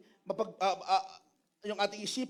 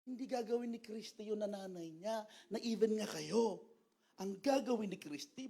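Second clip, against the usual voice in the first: normal talking voice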